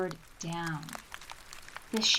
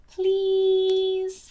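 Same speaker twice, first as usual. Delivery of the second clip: high pleading wolf voice; wolf begging; 9 semitones higher, 6 dB louder than usual. Acted. Wolf begging Betsy to come along, high pleading whine. Wolf Zemo begs, far voice.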